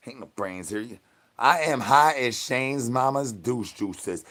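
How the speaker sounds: normal talking voice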